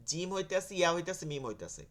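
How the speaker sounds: ordinary talk, nothing marked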